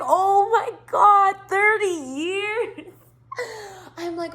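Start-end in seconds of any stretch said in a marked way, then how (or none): 0.0-2.9 slow, much higher, stretched-out awe; amazed. Retold or imitated. To the driver together thirty years, slow amazement. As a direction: drag out the disbelief. Reacting to thirty-year engagement.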